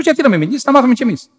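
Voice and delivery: high, loud retold objection; eager protest. Priest asking to learn the secret, eager protest. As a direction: protest with the voice pitched right up.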